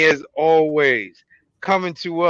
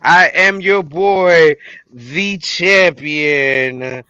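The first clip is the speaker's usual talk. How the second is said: hype man intro; 3 semitones higher, much slower than usual. Acted. Hyped self-introduction.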